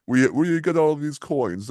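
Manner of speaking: high, loud hammed-up Italian voice; bad Italian accent, insistent. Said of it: "Italian accent".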